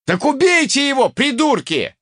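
high, exasperated yell; crying out. A narrator's reading. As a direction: yell at idiots to act, high. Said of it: "возопил я". (возопить с досадой)

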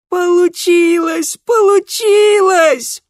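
very high, loud joyful shriek; happy squeal. A narrator's reading. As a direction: squeal with childish delight. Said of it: "счастливый визг". (счастливый визг)

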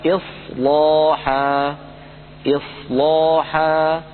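slow chanted إصلاحا with a heavy لام; إصلاحا drawn out, لام filling the mouth; Quranic recitation. Shown as a demonstration. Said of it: "قوله تعالى".

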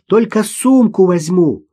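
put-on high, slow girl's call; girl calling back. A narrator's reading. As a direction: call back as a child, drawn out. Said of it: "крикнула в ответ девочка". (девочка протяжно кричит в ответ)

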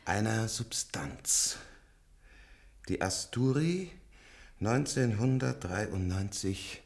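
drawn out, each word weighed; slow and hushed. Acted. Old patient lectures the nurse slowly and hushed. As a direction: savour the name slowly. Slow reverent praise of drug.

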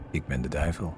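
low, sinister man's voice; strange man's voice. A narrator's reading. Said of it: "Vreemde mannenstem".

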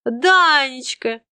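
drawn-out tipsy call, high and loud; drunk. Acted. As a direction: call his name like a happy drunk. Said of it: "пьяно заулыбалась я".

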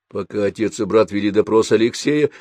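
usual speaking voice

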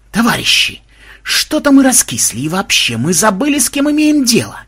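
high, rousing oratory; rally the crowd with fervour; fiery speech. A narrator's reading. Ksenobait addressing villagers from a barrel, fiery oratory. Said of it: "обратился с пламенной речью к аборигенам".

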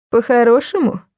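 насмешливое переспрашивание выше; переспроси с издёвкой; усмешка. Acted. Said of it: "усмехнулся он". (насмешливо переспрашивая)

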